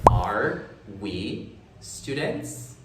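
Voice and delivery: very slow question with a rising end; slow model question. Shown as a demonstration. Teacher shows the inverted question, very slow. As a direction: draw the question out slowly for learners.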